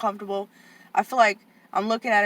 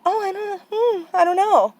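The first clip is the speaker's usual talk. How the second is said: high, airy put-on voice of the staff lady; sing-song dismissive lady voice; mocking the staff lady; 11 semitones higher, 5 dB louder than usual. Aunt mocking the evasive staff lady, high and airy. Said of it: "the lady's going".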